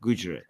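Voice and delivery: confirming the GOO pronunciation of "Gujarat"; correct Gujarat. Shown as a demonstration. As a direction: model "Gujarat" as GOO-juh-rat. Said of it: "Just say Gu".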